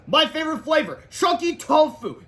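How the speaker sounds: high, loud, savoring the last words; teacher's announcement. A narrator's reading. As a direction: stretch out the awful reveal. Teacher's gleeful awful reveal.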